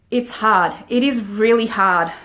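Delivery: ordinary delivery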